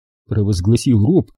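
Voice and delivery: ordinary talk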